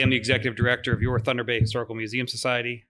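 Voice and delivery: normal talking voice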